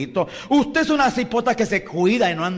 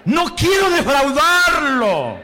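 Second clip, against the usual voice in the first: slow, much higher and louder cry; fervent shout; 10 semitones higher, 6 dB louder, slower than usual. Shown as a demonstration. Preacher having the flock repeat it, slow fervent shout. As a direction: plead it out with full heart.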